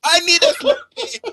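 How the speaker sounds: high, loud mock-hysterical cries; hammed-up excited shouting. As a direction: shriek as if overcome by the spectacle. Accented mock cast at its climax.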